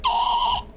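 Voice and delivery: very high startled squeal; dolphin-like squeal. Real, not acted. Player startled mid-game, letting out a dolphin squeal. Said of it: "I sounded like a dolphin".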